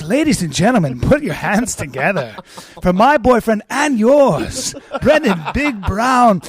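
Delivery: hammed-up fight introduction, loud and drawn out; mock ring announcer. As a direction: announce the fighter with slow, stretched words. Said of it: "I wish I could be an announcer".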